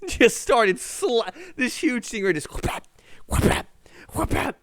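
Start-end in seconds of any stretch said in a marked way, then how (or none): 2.5-4.6 rhythmic mouth noise of a fin slapping water; playful splash effect; imitated slapping sound.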